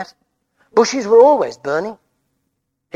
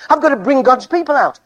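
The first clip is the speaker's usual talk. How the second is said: raised, louder urgent declaration; insistent; 5 semitones higher, 5 dB louder than usual. Acted. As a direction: press the mission home emphatically. Moses insists on his mission.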